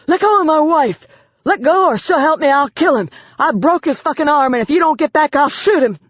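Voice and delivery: high, loud, desperate yelling; frantic threats. A narrator's reading. Cornered husband screaming threats at the officers. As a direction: yell like a cornered man with a gun.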